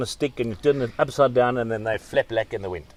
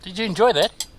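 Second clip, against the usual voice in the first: teasing; 9 semitones higher, 4 dB louder than usual. Real, not acted. Teasing co-host about eating.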